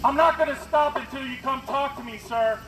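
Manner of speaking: ordinary talk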